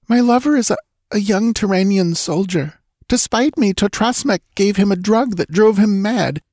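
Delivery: put-on frightened young woman. A narrator's reading. Girl voiced in story.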